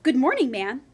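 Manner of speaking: bright, polite hello to a stranger; polite greeting. Acted. Jack greeting the giant's wife, politely. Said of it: "quite politely".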